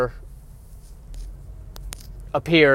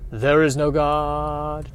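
slow grim declaration; much slower than usual. Acted.

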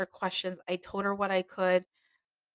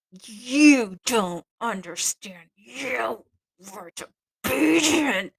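loud, slow, rising higher on disobedient; mocking scolding; 3 semitones higher, 7 dB louder, slower than usual. Mistress accusing her again, loud and mocking. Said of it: "I get the whole".

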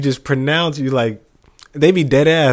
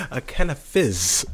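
laughing; 6 semitones higher than usual. Real, not acted. Laughing at the British word.

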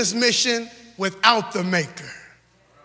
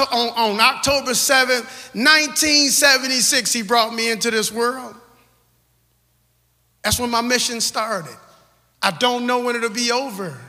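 ordinary talk, nothing marked

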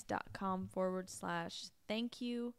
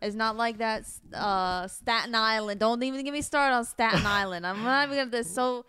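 louder mock New York rant; brash Long Island accent building up; Long Island accent, worked up about Staten Island; 5 dB louder, faster than usual. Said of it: "Long Island voice".

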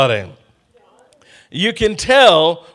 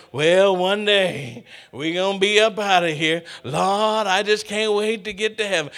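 lightly voiced old churchgoing grandma, softer; grandma's longing; 6 dB quieter than usual. Grandma and aunt waiting to get to heaven, wistful imitation. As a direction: imitate an older relative pining for heaven. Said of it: "listening to grandma and listening to aunt".

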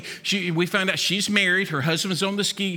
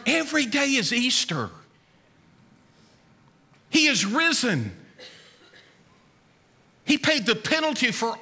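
raised-pitch, slow gospel proclamation; preach; 4 semitones higher than usual. Real, not acted. Preacher tells the congregation Christ is risen, earnest proclamation. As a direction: proclaim it to the congregation, drawing out key words.

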